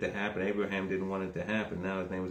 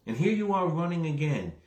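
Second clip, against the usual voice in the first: raised-pitch rebuke from the pulpit; impassioned preaching; 8 semitones higher, 3 dB louder than usual. Acted. Preacher voicing God's rebuke of Hagar for running, impassioned preaching. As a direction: preach it as a repeated, emphatic scolding.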